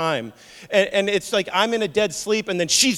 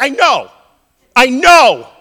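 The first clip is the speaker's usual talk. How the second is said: loud, high playing along; mock agreement; 8 semitones higher, 16 dB louder, slower than usual. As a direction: humour her loudly. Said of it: "And I'm like".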